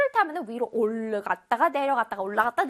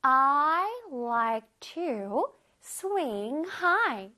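slow example read; much slower than usual. Shown as a demonstration. Teacher reading example to children, very slow. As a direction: spell out the sentence slowly for kids. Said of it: "우리 친구들 잘해?".

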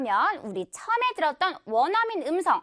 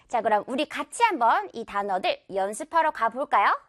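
normal talking voice

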